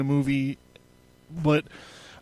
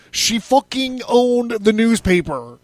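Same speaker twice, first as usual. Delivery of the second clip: loud, high, slow incredulous emphasis; exasperated rant; 7 semitones higher, 6 dB louder than usual. Real, not acted. Podcast host scoffing at newspaper owner's equality story, loudly exasperated. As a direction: raise your voice in drawn-out disbelief.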